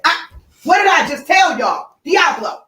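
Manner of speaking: high, loud yell at the dogs; angry shout. Real, not acted. Owner yelling at her fighting dogs, loud and angry. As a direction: bark the order so they stop.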